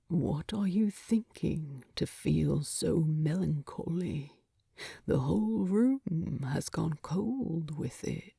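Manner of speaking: soft murmured question, half whispered; low quiet Thorin. Acted. Thorin gently asking a sad Bilbo, low and hushed. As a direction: ask gently from the pillow.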